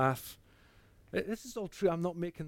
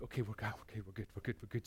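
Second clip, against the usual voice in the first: soft reassurance; 4 semitones lower, 11 dB quieter, faster than usual. Retold or imitated.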